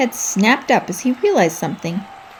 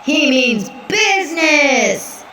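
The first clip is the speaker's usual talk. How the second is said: slow, stretched arena shout; announcer shout; 6 semitones higher, much slower than usual. Acted. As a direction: bellow it to the stands.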